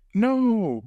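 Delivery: high, drawn-out mock "no"; mock protest. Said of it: "I wouldn't be like".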